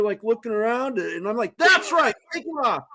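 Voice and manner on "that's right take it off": retold yell. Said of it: "I'm yelling at this guy".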